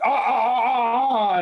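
high, rhythmic imitated moans; mock moaning. Retelling the railing story, high mock moaning. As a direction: act out rising cries of "ah".

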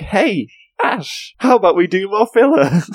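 mock Misty voice.

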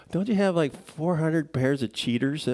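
high mock kid voice, slowed on the end; teasing kids. Kids ribbing dad about his cheaters, high mock voice. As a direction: playfully imitate his kids needling him.

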